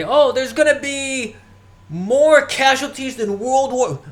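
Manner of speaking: put-on high, loud, slow old-man rant; a hammed-up Bernie Sanders warning; Bernie Sanders impression. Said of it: "you hear Bernie Sanders say".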